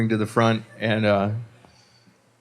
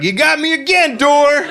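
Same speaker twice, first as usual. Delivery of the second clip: very high, loud holler at a door; hammed-up high-pitched yell; mock triumphant shout; 5 dB louder than usual. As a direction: holler it like a good-natured loser. Barista acted, addressing the door.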